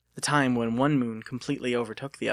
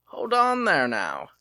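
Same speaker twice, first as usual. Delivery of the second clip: put-on old-timer shopkeeper; 5 semitones higher, 4 dB louder than usual.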